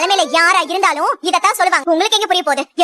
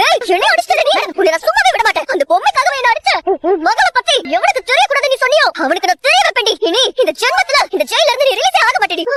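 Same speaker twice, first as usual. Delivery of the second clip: higher-pitched outraged threatening; furious threat; 5 semitones higher than usual. Acted. Struck warden threatening the prisoner, furious. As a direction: rage and vow revenge.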